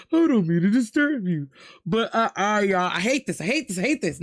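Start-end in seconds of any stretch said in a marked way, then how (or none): 0.1-1.5 a lightly voiced timid, well-mannered ghost; polite ghost voice. Imagining the crying ghost's manners, lightly voiced polite ghost. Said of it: "that's how he would sound".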